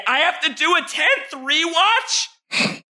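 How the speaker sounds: high, slow, whiny disbelief; mock whine. Host whines to the creator about another rewatch in a high mock voice. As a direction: stretch it out like a put-upon fan. Host retells his own whining question.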